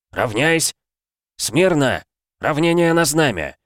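barked parade-ground commands, high and loud; shouting drill sergeant. Acted.

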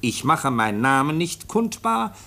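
reading a letter aloud. Acted. Officer reads the foundling's letter to the chief, slow formal reading. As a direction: read out each word slowly and carefully. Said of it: "Ich lese mal vor".

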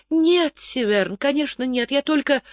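lightly voiced young woman, hurried excuses; Zarit, excusing herself. A narrator's reading. Zarit denying, faltering.

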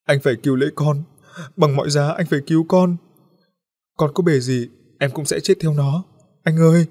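high, loud wailing plea. A narrator's reading. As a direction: wail at him to save the boy at any cost. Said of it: "khóc rống lên".

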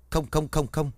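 Mạnh stammering to the guard in fright; terrified stammer. Acted. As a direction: trip over every word in fear.

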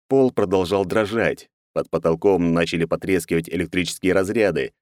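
ordinary delivery

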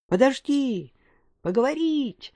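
distant call carried by wind; faint far-off shout. Acted. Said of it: "слабо донёсся крик Эсме".

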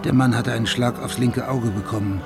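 usual speaking voice

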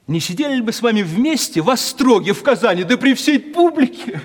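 громко, с вызовом, высоко; огорошь его дерзостью; дерзко. Retold or imitated. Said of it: "говорю". (дерзко пугает знакомого отца острогом)

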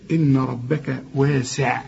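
"إن ربك واسع" slow, heavy ra, light nun, ba and kaf; correct tajweed. Shown as a demonstration. Teacher giving the correct slow recitation of "إن ربك واسع". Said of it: "وبعدين رقق الباء والكاف".

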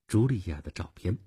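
ordinary delivery

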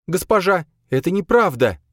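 high, loud, alarmed plea; alarmed woman's denial; frightened protest. A narrator's reading. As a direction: plead urgently with your mistress in a high voice. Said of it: "Боя испуганно вытянулась".

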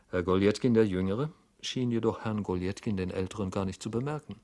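plain reading voice

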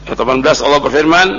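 usual speaking voice

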